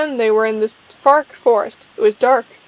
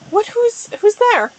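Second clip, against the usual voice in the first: startled; 9 semitones higher than usual. A narrator's reading. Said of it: "she said, jumped".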